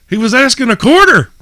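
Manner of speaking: high, loud cheering punch; punchline shout. Retold or imitated. The comedian cheers the ashtray seller, high and loud.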